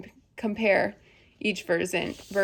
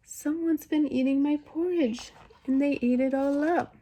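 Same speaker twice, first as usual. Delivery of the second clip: high, little bear cub; small, high-pitched storybook baby bear; wee small Baby Bear voice; 6 semitones higher than usual. A narrator's reading. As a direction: make yourself tiny and high, complaining. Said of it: "his wee, small voice".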